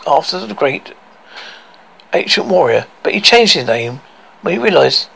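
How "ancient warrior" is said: ordinary delivery, nothing marked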